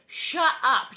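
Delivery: loud, high snapping retort; Laura snapping at Carrie; snapped shut up. Retold or imitated. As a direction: bark it back at her. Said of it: "Actually said".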